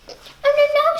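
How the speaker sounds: high, loud squeak of refusal; frightened little fly. Acted. Little fly refusing the spider in alarm. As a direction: recoil with a shrill no.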